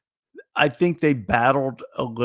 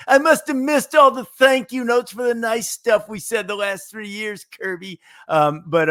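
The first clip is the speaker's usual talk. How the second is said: high whiny mocking voice, pitched way up; Bill Raftery impression; 9 semitones higher, 5 dB louder than usual. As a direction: whine it like a put-out announcer. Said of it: "that was a Bill Raftery impersonation".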